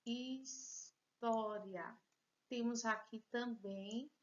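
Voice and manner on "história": very slow, syllables pulled apart; slow syllable split. Shown as a demonstration. Teacher showing pupils the three syllables, slowly. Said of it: "Três sílabas".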